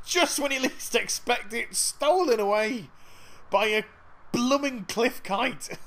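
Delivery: high, loud, incredulous exclaiming; amused outrage. Real, not acted. Player to viewers as a cliff kite steals it, amused outrage. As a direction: draw out the words in amused outrage. Outburst at bird stealing his gift.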